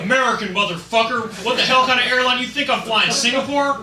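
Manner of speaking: loud, high patriotic rant; belligerent, hammed-up patriot yelling at the driver; true US patriot. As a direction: shout it with outraged national pride. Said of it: "U S patriot".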